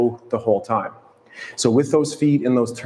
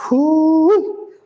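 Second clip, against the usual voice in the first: sudden loud high whoo at the strain of the hold; high effort whoop; 5 dB louder than usual. Real, not acted. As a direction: let out a bright effort cry at the peak. Effort during held leg lift.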